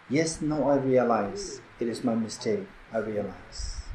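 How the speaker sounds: low, soft and slow, retold; tearful realization. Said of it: "she started to cry".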